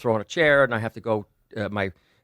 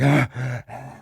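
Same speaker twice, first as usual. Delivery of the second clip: out of breath; 3 semitones higher than usual. Retold or imitated. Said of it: "you know, out of breath".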